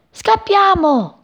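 high, drawn-out shout to flee; urgent little bird calling out; alarmed cry. A narrator's reading. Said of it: "gridò Cipì".

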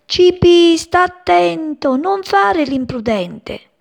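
high, slow warning from mother bird. Acted. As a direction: caution the little one gently but firmly. Mother warns chick.

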